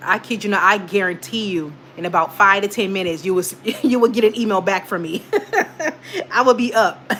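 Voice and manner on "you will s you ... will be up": real giggles breaking into the words; amused, breaking up mid-sentence; laughing. Real, not acted. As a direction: laugh through it, higher pitched. Amused at own promise to streamer's viewers.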